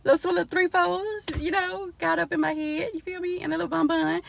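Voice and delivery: high, quick bragging voice; proud. Retold or imitated. Vlogger bragging about her styled hair, high quick proud voice. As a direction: show off the little twists. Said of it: "I'm like".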